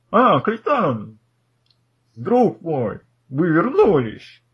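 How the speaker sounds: lightly voiced absent-minded scholar; delighted old professor. GM voices the professor greeting captain.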